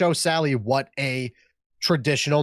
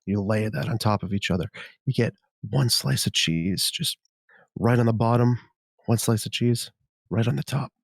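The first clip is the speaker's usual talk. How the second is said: ASMR voice. Pretend. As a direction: savour every word like a seductive ASMR whisperer. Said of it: "he said the first three words and he moaned".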